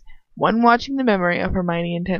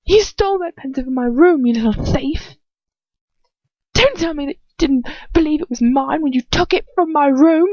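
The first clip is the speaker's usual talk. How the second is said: much higher, hard accusing fury; spitting words; 8 semitones higher, 3 dB louder than usual. Acted. Enraged wizard accusing the girl of theft, spitting fury. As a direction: spit every accusation. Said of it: "He spits his words at me".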